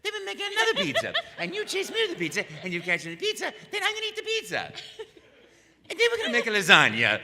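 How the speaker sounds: high, bright Italian Mario voice; happy friendly Italian plumber, way up high. Retold or imitated. Mario improvising a pizza game at the audition, high Italian voice. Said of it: "so I said".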